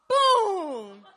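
a big, high shouted boom; mock explosion of thrown tea; loud boom. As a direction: slam out the sound of the splash. Throwing tea in his face.

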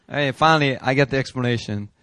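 normal talking voice

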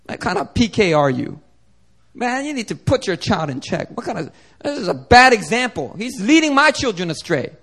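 imitating scolding elders, pitch climbing; harsh church elders. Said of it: "they're very harsh about it".

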